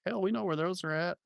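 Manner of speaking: high, bright country exclamation; eager to help a stranger; cheerful local family. Retold or imitated. Family in the grocery store offering help, high and eager. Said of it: "And they said".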